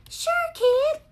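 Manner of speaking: very high squeaky Barbie voice; [name] quote. Retold or imitated. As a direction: squeak it like the doll in the song. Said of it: "they're from the song [name]".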